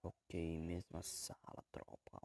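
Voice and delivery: soft, fading whisper. Real, not acted. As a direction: whisper to not wake anyone. Keeping quiet for sleepers.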